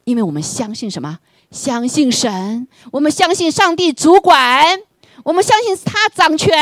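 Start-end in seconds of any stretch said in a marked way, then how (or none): 1.5-6.6 high, loud, slow proclamation; full-force preaching. Acted. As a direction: declare it to the congregation, rising. (高声慢速地宣讲)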